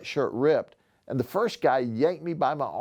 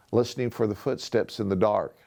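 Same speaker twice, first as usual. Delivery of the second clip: usual speaking voice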